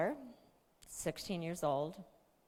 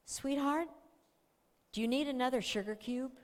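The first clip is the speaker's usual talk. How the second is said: gentle tease; 7 semitones higher, faster than usual. Retold or imitated. Mom teasing gently.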